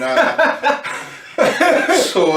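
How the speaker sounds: high, loud burst of laughter; laughing at his own story. Real, not acted.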